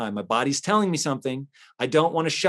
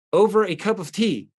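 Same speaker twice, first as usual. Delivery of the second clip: incredulous; 5 semitones higher than usual. Real, not acted. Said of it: "was over a cup of tea".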